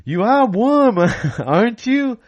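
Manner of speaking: [name] impression. Singer teasing the warmed-up crowd as Dylan. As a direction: ham up the Dylan voice. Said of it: "the outrageous [name] accent".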